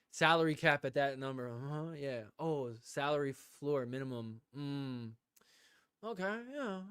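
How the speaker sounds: quiet, hollow yeahs pretending to follow; faking interest, mumbling uh-huhs; fake agreeing. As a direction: nod along as if you understand. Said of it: "pretend like I knew what he was talking about".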